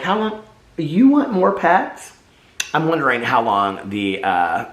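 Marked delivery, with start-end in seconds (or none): 0.0-2.1 high, slow sing-song pet voice; baby talk to dog. Retold or imitated. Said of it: "So I'm like".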